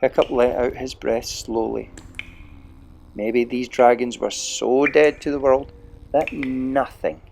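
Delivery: usual speaking voice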